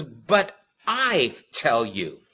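"I" drawn out slowly and set apart; stress on I. Retold or imitated. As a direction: lean hard on the word "I". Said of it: "there is an emphasis on the word".

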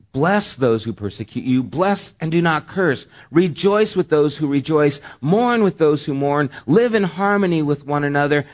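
loud, high scripture reading; preaching scripture. Acted. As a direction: proclaim the verses to the congregation. Reads scripture at full force.

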